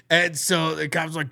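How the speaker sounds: ordinary talk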